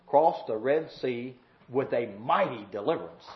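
low, soft praying voice; earnest prayer. Real, not acted. Preacher asking the Lord to help remember, quiet prayer. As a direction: come down low and quiet to pray.

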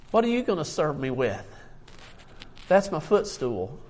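ordinary talk

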